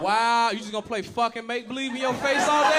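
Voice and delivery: loud, drawn-out mock-disgusted wow; scornful mimicry. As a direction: throw the disapproval back, hammed-up. Throws ex's scornful wow back.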